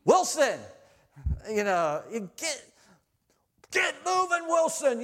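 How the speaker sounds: high, loud bark of a name; coach yell. Retold or imitated. Coach yelling at young Wilson, loud and high. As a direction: yell him into action. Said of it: "My coach yelled".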